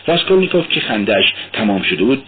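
plain reading voice

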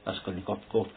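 usual speaking voice

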